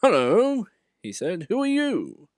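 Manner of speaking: high, loud bright greeting; cheeky engine voice. A narrator's reading. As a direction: greet him boldly.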